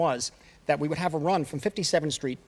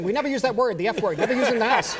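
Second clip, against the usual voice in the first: higher, laughing retelling of mother; laughing quote; 6 semitones higher, 4 dB louder than usual. Retold or imitated. Director laughs retelling his mother's line to strangers. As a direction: chuckle through mother's words. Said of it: "strangers, saying".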